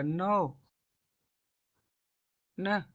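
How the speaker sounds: ordinary talk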